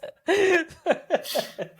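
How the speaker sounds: high, warm burst of laughter; kind gentle laugh. Real, not acted. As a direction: laugh along, kindly and amused. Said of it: "Sorry, I'm not laughing at you".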